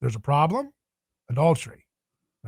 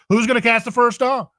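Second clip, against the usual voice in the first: Jesus challenging the crowd; 9 semitones higher, 6 dB louder than usual. Retold or imitated. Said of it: "He says".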